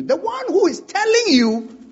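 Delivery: "telling" pushed up high and leaned on; stress on telling. Shown as a demonstration. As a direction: punch "telling" to set it apart. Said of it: "The one who said what you're about to hear".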